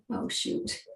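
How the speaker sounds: ordinary talk, nothing marked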